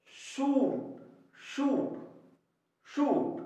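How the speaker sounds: "शूट" high, with a long oo; model pronunciation. Shown as a demonstration. Teacher shows the long u in shoot, model pronunciation. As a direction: repeat "शूट" separately, each on a high long oo. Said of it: "बड़ा ऊ की ध्वनि".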